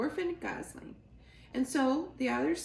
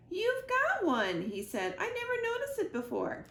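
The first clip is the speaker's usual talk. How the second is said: put-on high child voice; 11 semitones higher than usual. Gosling amazed by mother's button.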